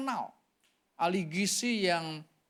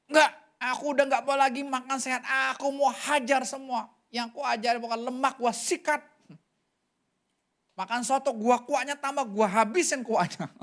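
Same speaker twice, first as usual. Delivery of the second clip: louder, higher mock tantrum, ending in a laugh; retold defiant anger; 5 semitones higher, 4 dB louder than usual. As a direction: play his stubborn defiance with a grin. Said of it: "Sampai dia marah sama Tuhan kan". (amarah membangkang yang ditirukan, lebih keras dan tinggi)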